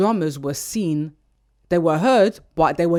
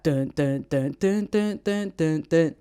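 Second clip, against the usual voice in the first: vocalised guitar notes stepping up in pitch; sung-out guitar delay; imitated delayed guitar. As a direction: mimic echoing guitar notes with evenly spaced duns. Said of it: "there's delay".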